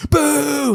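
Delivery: long high held boo; imitated crowd boo. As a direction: draw out a mock jeer on one high note. Acts out the crowd booing.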